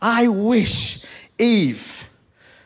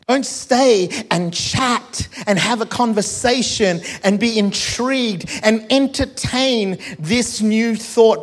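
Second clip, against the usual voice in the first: ordinary delivery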